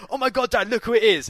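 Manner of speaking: re-enacted thrilled outburst; retold excitement. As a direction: nudge dad with an excited cry. Retells excitedly alerting dad.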